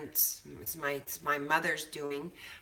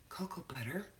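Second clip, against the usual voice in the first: whisper; 7 dB quieter, faster than usual. Real, not acted. Aside while cooking.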